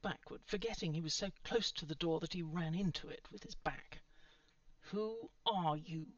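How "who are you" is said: slow, menacing growl; drawn-out, threatening question; growled demand. A narrator's reading. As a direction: growl it slowly at an intruder. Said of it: "the girl growled".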